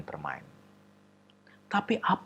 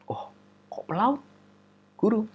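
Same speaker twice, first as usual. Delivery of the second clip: put-on curious child; 10 semitones higher than usual. Child asks teacher.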